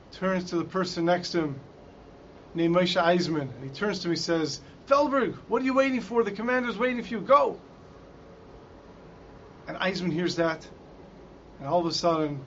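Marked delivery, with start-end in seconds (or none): 4.9-7.6 higher, insistent voice; urgent prodding. Retold or imitated. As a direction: urge a fellow prisoner to move at once. Prisoner urging another in story.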